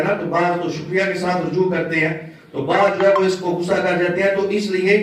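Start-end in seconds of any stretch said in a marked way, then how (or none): none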